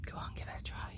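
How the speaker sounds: soft coax to try it; whispered coaxing. Real, not acted. Owner whispering to chickens to try the food. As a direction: whisper the urging.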